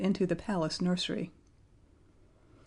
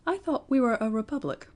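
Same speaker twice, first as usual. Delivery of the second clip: higher, lightly voiced little boy; puzzled young Lionel; 5 semitones higher than usual. A narrator's reading. Lionel questions the Prime Minister about the republic, puzzled boy's voice. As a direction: voice a small boy politely puzzled.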